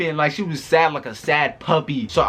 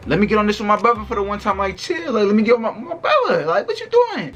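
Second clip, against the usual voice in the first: climbing higher and faster, cracking into a laugh; exasperated mock pleading; 7 semitones higher than usual. Asking Stank Breath to let him ride with his brother, rising in exasperation. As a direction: repeat it like she should already get it, voice rising each line.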